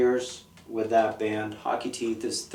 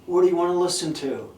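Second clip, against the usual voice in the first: quick, raised, put-on voice asking a friend; mock fast high question; 6 semitones higher, 4 dB louder, faster than usual. Driver asking his friend about music, quick and raised. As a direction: rattle it off high like a bored driver. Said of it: "and I'd be like".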